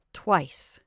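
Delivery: usual speaking voice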